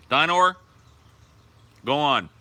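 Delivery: high, loud call to a pet; calling the dog. Real, not acted. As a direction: call the dog over brightly.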